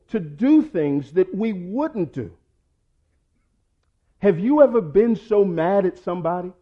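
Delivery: high, loud preaching climax; preaching at full force. Acted. Pastor warns the congregation about anger, loud and high. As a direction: shout the warning to the church.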